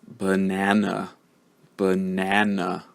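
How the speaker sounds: "Banana" said slowly, middle syllable long and strong; middle-syllable stress. Shown as a demonstration. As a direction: stretch "Banana" for learners, weak schwa at both ends, weight in the middle. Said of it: "syllable that's stressed".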